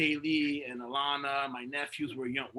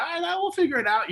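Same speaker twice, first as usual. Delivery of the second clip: high Jay imitation; 6 dB louder, faster than usual.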